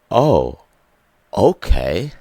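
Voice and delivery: mock offended acceptance; put-on sarcastic okay. Sarcastic reaction in story.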